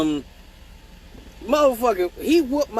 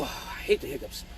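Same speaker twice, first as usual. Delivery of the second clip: ordinary delivery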